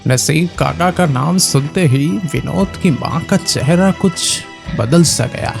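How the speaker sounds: ordinary delivery, nothing marked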